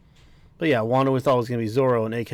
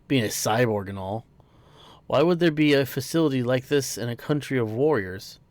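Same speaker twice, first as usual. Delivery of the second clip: normal talking voice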